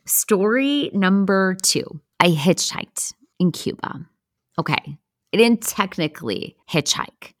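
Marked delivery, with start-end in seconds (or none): none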